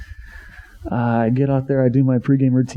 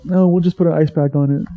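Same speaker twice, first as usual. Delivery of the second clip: higher, sing-song mock nurse; 5 semitones higher than usual. Pitcher mocking junior college trainers as school nurses, cheery mock voice.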